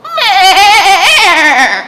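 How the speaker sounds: high loud laughing meh; goat bleat. Shown as a demonstration. Storyteller bleating as Goat, laughing. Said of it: "That's not a very good goat".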